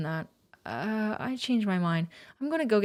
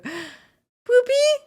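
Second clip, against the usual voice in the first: scared call; 10 dB louder than usual. Real, not acted. Calling character in fear.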